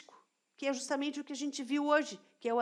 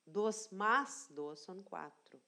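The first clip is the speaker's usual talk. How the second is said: slow stressed demo; 3 semitones lower, 3 dB quieter, slower than usual. Shown as a demonstration. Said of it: "teacher demo".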